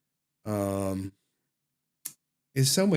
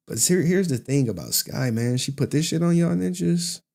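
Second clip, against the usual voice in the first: ordinary talk, nothing marked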